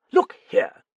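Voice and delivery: high, loud, indignant interruption; snapped. A narrator's reading. Arbour cutting off the detective's accusation, snapping. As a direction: burst in sharply to object. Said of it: "snapped Arbour".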